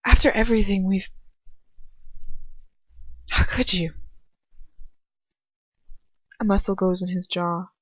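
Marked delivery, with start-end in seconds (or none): 0.0-3.9 anguished outcry. Acted. Confronting her parents' killer.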